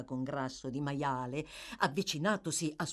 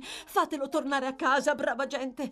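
high-pitched, insistent wailing plea; hysterical pleading; 9 semitones higher, 4 dB louder than usual. A narrator's reading. The girl begs bystanders to send him home, wailing. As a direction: beg the crowd with melodramatic distress.

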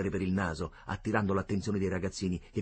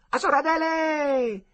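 slow long call, far higher and louder; hallway call, far higher, slow and drawn out; 7 dB louder, slower than usual. A narrator's reading. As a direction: call her down the corridor. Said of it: "gridò di nuovo per il corridoio".

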